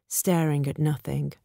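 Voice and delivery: ordinary delivery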